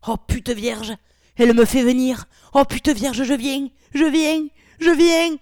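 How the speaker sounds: loud, high outburst climbing to a peak; sudden exclamation. A narrator's reading. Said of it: "s'exclama soudain".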